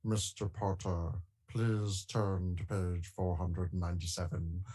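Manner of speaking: Alan Rickman impression. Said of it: "That's a really good impression".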